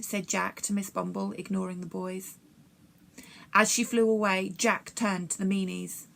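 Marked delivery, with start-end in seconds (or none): none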